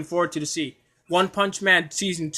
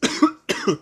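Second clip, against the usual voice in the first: a second real cough; 10 semitones higher than usual. Real, not acted. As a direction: cough once more, no words. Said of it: "My apologies for the cough".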